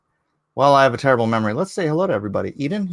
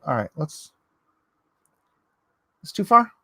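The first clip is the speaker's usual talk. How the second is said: ordinary delivery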